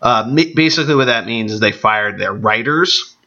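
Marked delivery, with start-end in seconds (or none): none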